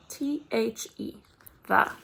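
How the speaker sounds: spelling letter by letter. Shown as a demonstration. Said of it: "spelling letters aloud".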